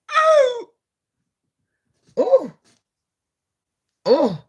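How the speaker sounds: excited oh. Real, not acted. Reacting to the song.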